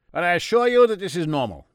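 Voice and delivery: put-on high, loud German-accented doctor; a jolly German doctor offering cocaine; Freud in German accent. A narrator's reading. Freud offers God cocaine, German-accented and high.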